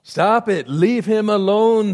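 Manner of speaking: a retold shout at the soldier; mock yell. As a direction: call it out like a jeering crowd. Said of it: "they yelled at him".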